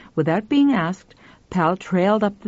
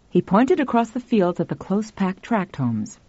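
ordinary delivery, nothing marked

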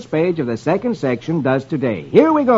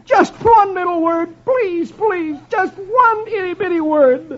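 high, drawn-out begging; desperate pleading; slower than usual. A narrator's reading. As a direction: beg as if on your knees. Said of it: "Dagwood is on his knees".

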